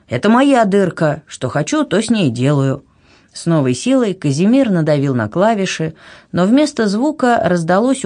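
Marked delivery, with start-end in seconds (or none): none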